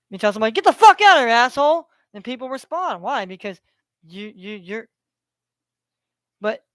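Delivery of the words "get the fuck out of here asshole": loud, high-pitched angry yell; abusive cussing. Acted. Cussing somebody out to get a response, loud and belittling. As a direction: yell it like you're chasing someone off. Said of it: "if you downgrade people and you talk shit to 'em".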